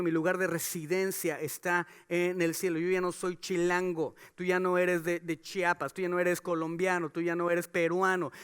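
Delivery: usual speaking voice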